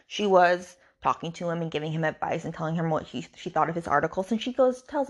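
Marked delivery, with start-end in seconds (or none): none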